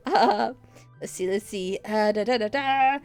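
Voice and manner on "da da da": ordinary talk, nothing marked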